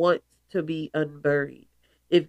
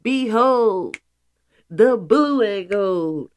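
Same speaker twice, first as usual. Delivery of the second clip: louder, slow and lofty; grand proclamation; 2 semitones higher, 5 dB louder, slower than usual. Pretend. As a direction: announce it like a herald. Grand announcement of colors.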